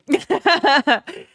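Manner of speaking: bursting out laughing; a loud high laugh at herself; embarrassed loud laugh. Real, not acted. Laughs at herself after crying story.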